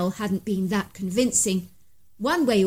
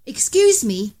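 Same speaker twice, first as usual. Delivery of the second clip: loud call of excuse me across the woods; 3 semitones higher, 6 dB louder, slower than usual. A narrator's reading. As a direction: call out to a stranger far away. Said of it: "I could hear Ivan calling".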